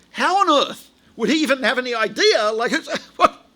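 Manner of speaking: high, incredulous, laughing; baffled disbelief. Retold or imitated. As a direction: act out total bafflement with a laugh. Acts out his baffled thought.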